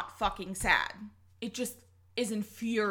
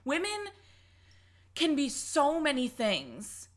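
fed-up rant rising high; exasperated rant; 5 semitones higher than usual. Real, not acted. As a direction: pitch climbing high with frustration. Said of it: "fucking tired of the narrative".

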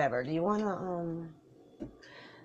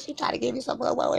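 ordinary delivery

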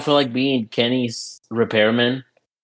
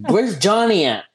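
calling out for Johnny in a higher voice; 8 semitones higher than usual. Retold or imitated. Squad searching for Johnny during the match, calling out. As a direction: shout across the map for a missing teammate. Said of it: "And we're like".

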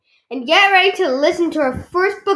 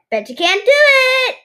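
high-pitched taunting yell; pitched-up kid's yell; 10 semitones higher, 4 dB louder than usual. A narrator's reading. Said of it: "yells Vi-, Violet".

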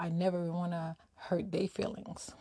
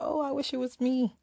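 mock wishful thought; 6 semitones higher, 6 dB louder than usual. Voices a common envious thought.

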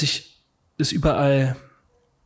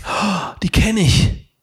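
slow, higher, drawn-out gasp of recognition; surprised recognition; 5 semitones higher, 3 dB louder, much slower than usual. Retold or imitated. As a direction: act suddenly recognizing old acquaintances. Acted reaction of delighted recognition.

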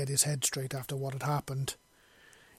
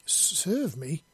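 stuttered question with a wavering pitch; fearful, surprised stammer; 2 semitones higher than usual. A narrator's reading. Startled wife questioning her husband, fearful stammer. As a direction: catch on the first sound, surprised and a little afraid. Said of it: "she stammered, the surprise and obvious fear hint in her voice".

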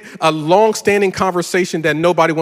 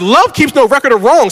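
higher and louder scripture retort; indignant quote; 7 semitones higher, 6 dB louder than usual. Retold or imitated. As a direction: throw the verse at her. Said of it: "I'm like".

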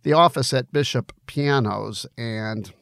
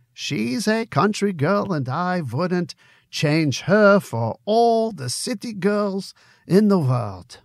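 Conrad the barkeep in a lightly put-on German accent; a loose, half-hearted German accent, friendly and teasing; 9 semitones higher, slower than usual. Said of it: "for my German accent".

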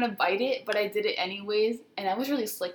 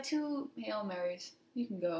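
quiet priest verdict; 9 dB quieter, slower than usual. Retold or imitated. Priest telling a child its penance, soft and slow. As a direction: hand down the penance unhurried. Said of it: "And he was like".

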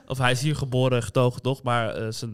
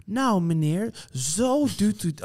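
higher, slow, patronizing sing-song; talking down as to a child; 5 semitones higher, slower than usual. Retold or imitated. Shop worker explains to an immigrant father as if he were a child, condescending. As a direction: imitate someone patiently over-explaining to a child. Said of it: "Alsof hij een kind is".

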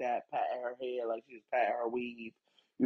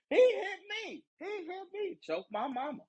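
very high, squealed falsetto; high-pitched parody of tattling; mock accusers. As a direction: squeal it like a piled-on mock complaint. Mimics people piling on accusations.

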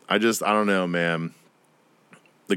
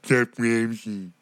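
slow, lightly put-on British accent naming the chef; slower than usual. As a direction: drawl the name in mock British. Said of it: "That's the voice I use".

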